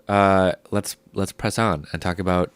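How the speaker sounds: normal talking voice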